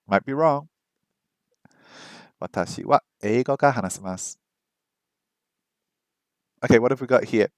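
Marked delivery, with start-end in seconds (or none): 2.4-4.4 quick, smooth read of Watashi wa eigo ga hanasemasu; faster read. Shown as a demonstration. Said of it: "I need to say it faster".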